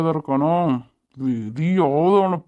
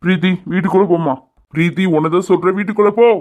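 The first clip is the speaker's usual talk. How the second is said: raised voice, climbing to a loud command; stern order; 4 dB louder than usual. Acted. Father sending his daughter inside, loud stern order. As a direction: order her indoors, louder on the repeat.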